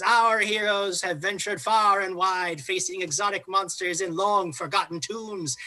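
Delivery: grand arena showman, pitch swooping high on key words; Struben. Acted. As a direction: proclaim theatrically to a bloodthirsty crowd. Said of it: "he stands up to address the whole audience".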